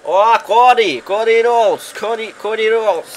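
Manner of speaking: shouting names ringside; wrestling commentator. Real, not acted. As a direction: hype up the wrestler's entrance. Excited calls of wrestlers' names.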